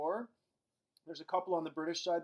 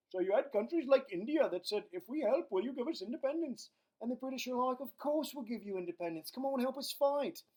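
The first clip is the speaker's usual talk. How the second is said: put-on raised, lilting Indian and British accents; 8 semitones higher than usual. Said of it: "how do you do so many accents?".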